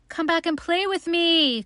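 high, pleading storybook call; little rabbit calling, voice lifted high. A narrator's reading. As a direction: call after departing friends. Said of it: "called the little rabbit".

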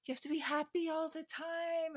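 sing-song it like a know-it-all guru; mocking toxic positivity. Mocking spiritual bypassers who demand constant happiness, in a sing-song mock voice. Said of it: "people will be like".

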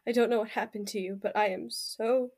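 soft, hushed, grieving apology; Ella whispering sorry at her friend's corpse; tearful whisper. Acted. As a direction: kneel and apologise through quiet tears. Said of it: "silently crying".

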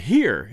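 here with a sounded R, pitched low; American pronunciation. A narrator's reading. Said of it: "We pronounce it".

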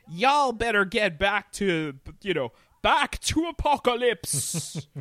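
loud, high Southern twang muddled with Cockney; hammed-up wandering accent, part Southern, part Cockney; mock Southern-Cockney accent. Mocking a film character's accent to a co-host, hammed-up Southern-Cockney. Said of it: "sounds like a Southern twang and just, like, a Cockney accent".

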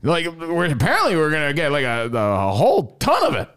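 high squealy weird voice; imitating Iowa Sam. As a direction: make him sound strange. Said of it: "He was like".

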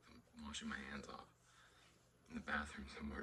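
hushed confiding whisper. Retold or imitated. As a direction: lean in and whisper it. Sneaking off to wash hands.

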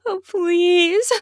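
high, gasping plea; breathless begging. A narrator's reading. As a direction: beg between heavy breaths. Breathless begging during love scene.

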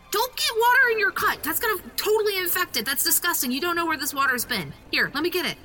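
high, fast frantic fussing; frantic germ-fussing kid. Acted. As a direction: rattle off the worries in a panic. Eddie fussing over Richie's cut.

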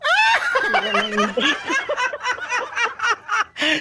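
very high, long cackle; shrieking laugh. Real, not acted. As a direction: burst into a piercing, high-pitched laugh. Host bursts out before catchphrase.

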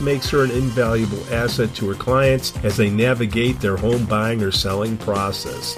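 upbeat sponsor read over music. Acted. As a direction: sell it like a radio spot.

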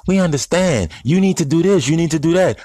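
a mocking imitation of public sympathy. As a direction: parody what people say in public.

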